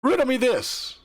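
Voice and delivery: lightly hammed-up riddle opener; mock riddler flourish. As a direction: announce it like a grand puzzle-master. Playful challenge to Sam.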